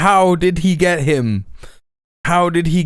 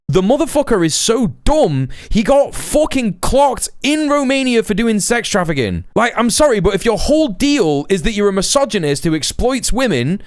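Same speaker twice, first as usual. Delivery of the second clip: heated, high-pitched argument; incredulous rant; 6 semitones higher than usual. Real, not acted. Streamer disputes that Andrew Tate is intelligent, incredulous rant. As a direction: argue hotly, pitch riding high.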